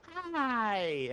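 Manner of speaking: very high squeaky greeting; thin falsetto, mock-friendly hi; nervous fake friendliness. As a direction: squeal a hello at the monster. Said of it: "As I'm sort of creeping around".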